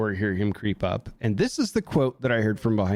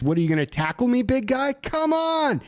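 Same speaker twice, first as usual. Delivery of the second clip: mocking, high-pitched challenge; hot with anger; 11 semitones higher than usual. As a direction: goad them, pitch climbing to the end.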